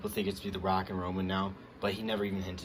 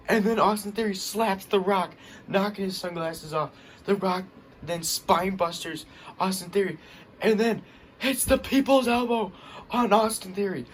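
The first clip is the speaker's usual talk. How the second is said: higher and louder play-by-play hype; excited; 11 semitones higher, 6 dB louder than usual. Real, not acted. Fan recapping the slap and elbow, excited. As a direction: race through the action, fired up. Narrating the brawl climax.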